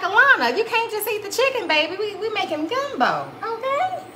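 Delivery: high, playful telling-off, laughing at the end; retold mock scolding. Mom retelling how she told Alana to wait, high playful scold. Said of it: "I'm like".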